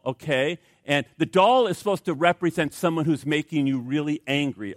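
normal talking voice

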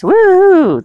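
loud high whoop of excitement; excited cheer. Real, not acted. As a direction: cheer it out with joy. Cheering the upcoming guest.

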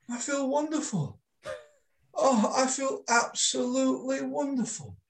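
high, dreamy, blissed-out voice; glowing with calm relief; blissful client. Retold or imitated. Client voiced after trance.

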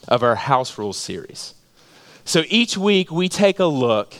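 normal talking voice

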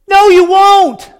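booming rebuke. Acted. Joshua shouting down the people's empty promise. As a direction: slam the door on their promise.

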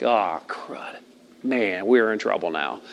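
low, soft retold dismay; deflated, under the breath; dismayed mutter. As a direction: sink into a quiet "we're in trouble". Dismayed aside as the deer runs off.

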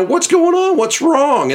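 high, baffled mock innocence; clueless tourist. Tourist puzzled by the ranger grabbing his son, mock-innocent. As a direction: play dumb, he has no idea what he did. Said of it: "And this guy's just".

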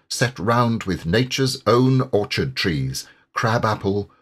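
usual speaking voice

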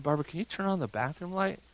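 soft, quick bedside murmur; hushed request. Retold or imitated. Dad asking his wife for light at night, hushed request. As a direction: ask quietly and quickly, keeping things calm.

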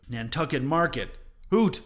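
loud, high scornful hoot; scornful scoff. A narrator's reading. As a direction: scoff at the idea. Ahab scoffs at Starbuck.

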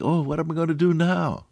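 high, mock-anxious wail; hammed-up helpless fretting; worried thought. Preacher voicing what we always think, mock worry. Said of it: "And always we think".

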